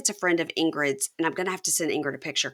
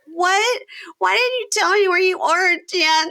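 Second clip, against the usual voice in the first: much higher, louder accusing; high upset girlfriend; 13 semitones higher, 5 dB louder, slower than usual. Retold or imitated. Taylor to Chance, high mock accusation. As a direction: demand an answer, hammed up. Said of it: "And she's like".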